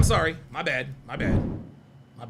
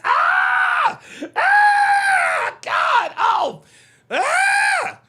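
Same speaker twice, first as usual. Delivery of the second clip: shrill laughing screams; shrieking jackpot winner; 7 dB louder, much slower than usual. Real, not acted. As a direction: scream like you won big. Screaming at chat joke.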